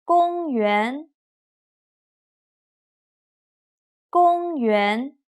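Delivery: slow drill of "公园", level then rising; first plus second tone. Shown as a demonstration. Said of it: "first tone plus second tone".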